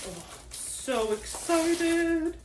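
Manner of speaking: high, very drawn-out coo; sing-song it slowly with anticipation; giddy excitement. Real, not acted. Vlogger opening the package, stretched-out excited squeal.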